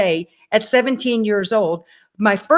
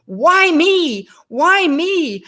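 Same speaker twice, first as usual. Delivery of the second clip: higher, restrained mock scream; half-voiced wail of self-pity, pitched up; retold anguished cry; 5 semitones higher, 3 dB louder, much slower than usual. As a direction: re-enact crying out in despair, held back. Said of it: "running out to my car screaming".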